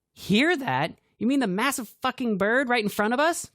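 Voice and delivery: high, loud sarcastic retort; sarcastic snap. A narrator's reading. Cassidy snapping at Grant, loud and sarcastic. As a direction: snap back with scorn. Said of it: "Cassidy snapped".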